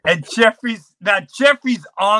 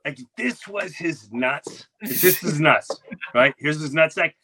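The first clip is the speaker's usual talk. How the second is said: normal talking voice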